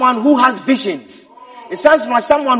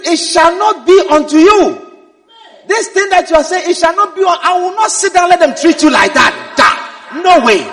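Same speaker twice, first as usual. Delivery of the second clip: loud, forceful protective protest; indignant outburst; 3 semitones higher, 6 dB louder than usual. Acted. A loyal follower defending his leader's respect, loudly indignant. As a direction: thunder out a refusal to accept it. Acting Peter's outraged protective rebuke.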